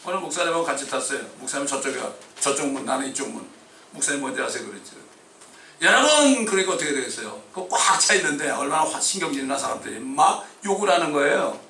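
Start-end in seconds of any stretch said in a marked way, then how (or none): 5.8-6.4 loud, high call to the crowd. Retold or imitated. Pastor calls out to a packed subway, loud high call.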